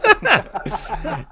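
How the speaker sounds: high, loud burst of laughter at the joke. Real, not acted. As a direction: break into a big real laugh. Laughs at accent request.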